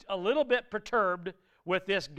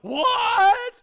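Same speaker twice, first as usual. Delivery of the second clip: high, loud startled outcry; shocked "what"; 14 semitones higher, 8 dB louder than usual. Retold or imitated. People in the pews reacting in disbelief, a loud, high shocked cry. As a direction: blurt it out in disbelief. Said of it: "there are some people like".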